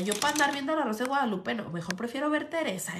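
normal talking voice

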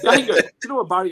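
high and loud, bursting in; excited outburst. Real, not acted. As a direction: burst out over the teasing. Guest erupts at the joke.

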